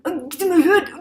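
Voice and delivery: high, loud pleading; desperate plea. Retold or imitated. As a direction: beg her to understand.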